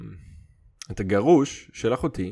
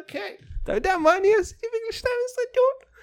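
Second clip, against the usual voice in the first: mocking high voice; faster than usual.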